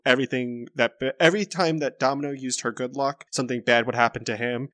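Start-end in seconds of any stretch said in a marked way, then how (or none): none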